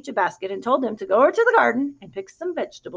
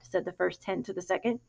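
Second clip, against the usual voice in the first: ordinary delivery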